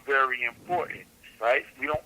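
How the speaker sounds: ordinary talk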